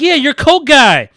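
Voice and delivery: high, slow, loud urging; hyped insisting. Retold or imitated. Friend pressing Ezra for his coke guy, high and slow. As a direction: drag out the demand like a wired friend.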